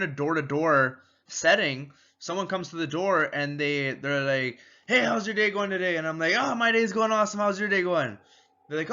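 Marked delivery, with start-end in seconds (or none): 4.9-6.0 upbeat customer greeting. Retold or imitated. Door-to-door customer greeting role-play. 6.4-8.2 bright, fast, higher excited reply; match the customer's energy back. Shown as a demonstration. Salesman matching a cheerful customer, excited and fast. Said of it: "you match their energy exactly".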